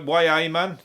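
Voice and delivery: imitated Geordie voice; Geordie accent. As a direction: do a broad Newcastle catchphrase. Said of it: "for the Geordies".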